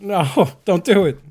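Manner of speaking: loud, slow, laughing no; laughing shout of dread; loud laughing plea. Real, not acted. Pleading with game character falling.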